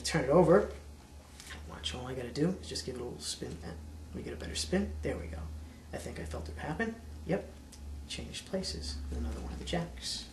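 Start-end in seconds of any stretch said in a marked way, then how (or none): 1.7-10.2 soft, fast murmured patter; hushed magician reveal. Acted. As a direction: drop to a near whisper.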